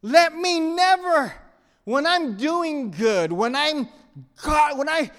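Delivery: high, loud, slow pleading prayer; fiery preaching. Acted. Pastor pleads with God before congregation, full-force preaching.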